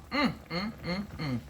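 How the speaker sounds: contented humming mm mm; savoring mm hum. Real, not acted. Cook savoring the aroma for viewers, humming mm. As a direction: hum like it already tastes good.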